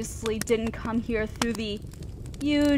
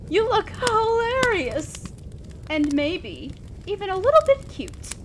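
amused teasing; 7 semitones higher than usual. Acted. Demon teases the sobbing mortal, amused and delighted. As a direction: stretch it out in a high, teasing lilt.

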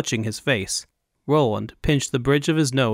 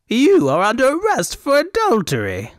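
high, loud pompous proclamation; indignant arrest shout; 12 semitones higher, 5 dB louder than usual. Acted. Governor loudly arrests the fop.

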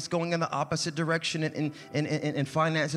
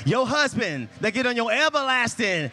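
a bit louder preaching cadence; rising preaching; 6 semitones higher, 5 dB louder than usual. Acted. Preacher reassuring the church, lifted preaching voice. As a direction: lean into it, stress better and God's.

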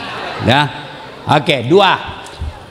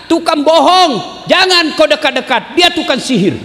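blind man shouting in the market; 10 semitones higher than usual. Retold or imitated. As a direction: rail at the crowd in a raised crier's voice. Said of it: "selalu teriak".

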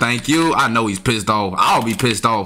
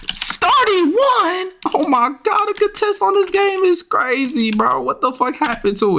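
much higher outraged disbelief; incredulous; slower than usual. Real, not acted. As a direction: yelp at the ridiculous number. Reacting to absurd contest number.

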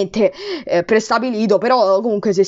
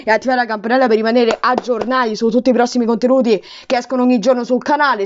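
ordinary talk, nothing marked

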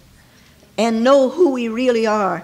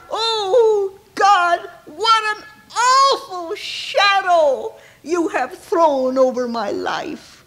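crying out; 6 semitones higher than usual. Retold or imitated. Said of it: "cried out".

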